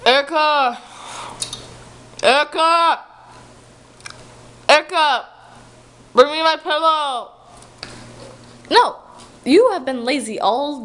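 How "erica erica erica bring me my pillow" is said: calling out. Acted. Dad calling for pillow.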